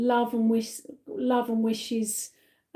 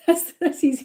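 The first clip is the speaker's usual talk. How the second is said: ordinary delivery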